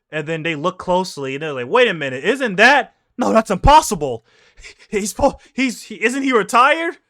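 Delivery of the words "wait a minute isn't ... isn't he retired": high, loud stunned disbelief. Retold or imitated. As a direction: gasp out that it can't be him. Stunned marines recognize Garp.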